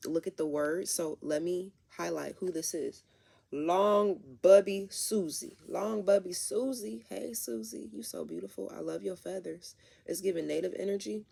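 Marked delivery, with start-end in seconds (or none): 3.5-7.1 loud, slow, drawn-out name; playful emphasis. Real, not acted. Reader announcing the card, loud playful emphasis. 7.1-9.7 cooing. Real, not acted. Cooing at card figure.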